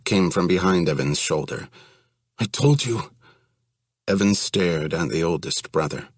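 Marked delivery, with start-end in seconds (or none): 2.4-3.1 husky with fear. Acted. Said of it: "husky with fear".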